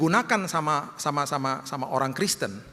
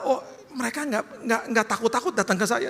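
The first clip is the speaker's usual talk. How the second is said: ordinary delivery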